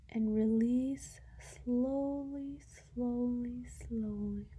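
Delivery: long, stretched words sinking away at the end; drawn-out calming. Real, not acted. Guide pacing the listener's breath release, slowly drawn out. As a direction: draw each "slowly" out like an unhurried exhale.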